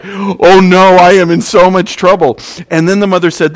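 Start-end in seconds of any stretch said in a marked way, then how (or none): 0.0-2.4 loud, higher cry of guilty alarm; guilty panic. Retold or imitated. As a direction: blurt it out like you've just been caught. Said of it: "he started feeling really guilty, like".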